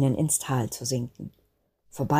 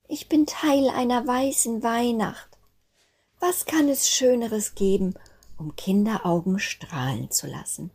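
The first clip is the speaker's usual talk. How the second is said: higher, awed little voice; joyful wonder; 7 semitones higher than usual. Acted. Snowflake's joyful realization.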